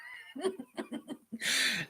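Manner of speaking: soft, high laugh. Real, not acted. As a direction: laugh quietly in a high pitch. Laughs to himself.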